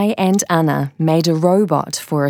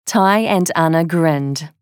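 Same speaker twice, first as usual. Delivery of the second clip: ordinary delivery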